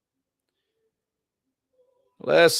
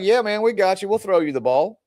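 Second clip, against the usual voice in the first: put-on higher-pitched, upbeat boyish reassurance; eager agreement; 6 semitones higher than usual. Boys reassuring their upset teammate, eagerly agreeable. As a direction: imitate boys readily agreeing.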